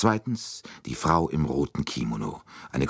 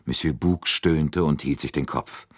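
usual speaking voice

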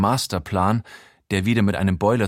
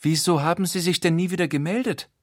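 hurt, high-pitched reproach; gekränkt; 8 semitones higher, faster than usual. A narrator's reading. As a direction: ask like a wounded, slighted lady. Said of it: "dann, gekränkt". (gekränkter Vorwurf in hoher Stimme)

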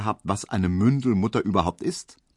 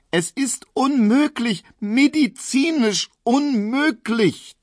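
much higher, loud, slow, strained; shrill wailing protest; 5 dB louder, slower than usual. A narrator's reading. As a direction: wail it in helpless outrage. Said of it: "zeterte er".

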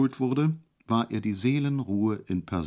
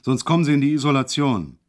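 loud, higher threat; bellowing; 4 semitones higher, 5 dB louder than usual. Acted. Orderly threatens Hejula with isolation, loud bellow. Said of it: "brüllte er auf einmal los".

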